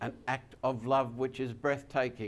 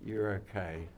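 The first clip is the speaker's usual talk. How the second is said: softer, lower reassurance; soft heavenly voice; 6 semitones lower, 6 dB quieter than usual. Acted. Preacher softly voicing God to those coming forward, hushed. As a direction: murmur it like God's comfort. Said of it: "hear the voice that says".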